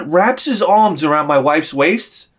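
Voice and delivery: ordinary delivery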